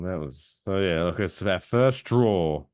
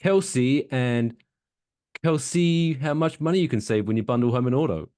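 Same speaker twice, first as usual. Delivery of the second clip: Travis Kelce; 7 semitones higher, 5 dB louder than usual. Retold or imitated. Acts out Kelce in the commercial.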